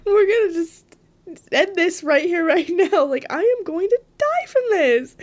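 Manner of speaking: loud, high, frantic pep talk to the mirror; half-laughing, urgently telling yourself you might die; panicked self-talk. Retold or imitated. Alone in a hotel, talking to her own terrified face, loud and panicked. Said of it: "being like".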